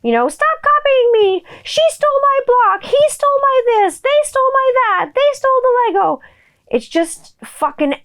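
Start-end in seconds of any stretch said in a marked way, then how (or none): 0.3-6.2 put-on very high, tattling child voice; kindergartener tattling. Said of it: "transports me back to the days of kindergarten".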